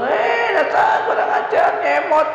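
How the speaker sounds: mock angry parent shouting; scolding shout. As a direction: yell at the naughty kid, high and sharp.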